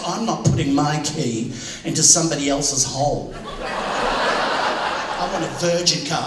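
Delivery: mock wife voice. Husband mimics his wife refusing a used car, low and slow. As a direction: drag out a haughty demand.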